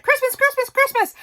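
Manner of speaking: squealing it way up high and loud; giddy Christmas excitement. Retold or imitated. Journaler recalling how giddy she gets before Christmas, high excited squeal. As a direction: burst out like a kid who can't wait for Christmas. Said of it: "and I'm like".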